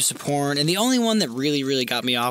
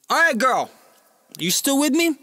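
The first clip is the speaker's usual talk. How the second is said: high, raised mock check-in; calling out; 11 semitones higher, 4 dB louder than usual. Man calling to waiting client, high raised voice. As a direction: call over to her, pitched up.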